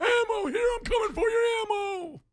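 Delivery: high, loud zombie shout. Pretend. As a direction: roar it like a zombie chasing. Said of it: "do the zombies in Back for Blood".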